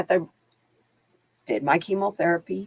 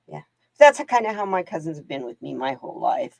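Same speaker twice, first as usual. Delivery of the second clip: ordinary talk, nothing marked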